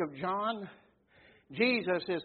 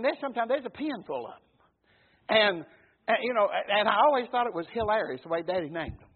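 ordinary talk, nothing marked

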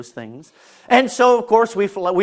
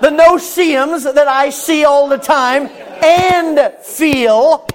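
higher, louder, slowed preaching cadence; preaching at full force; 10 semitones higher, 7 dB louder than usual. Acted. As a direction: preach it with building fervor.

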